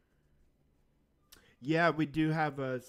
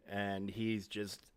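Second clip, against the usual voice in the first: ordinary talk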